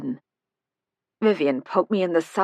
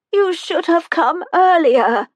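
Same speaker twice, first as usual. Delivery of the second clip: put-on thin, high, slow frail old voice; a tiny old woman calling from the doorway; high-pitched old woman; 12 semitones higher, 8 dB louder than usual. A narrator's reading. Old resident greets the visitors, high-pitched and slow. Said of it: "she said in a high-pitched voice".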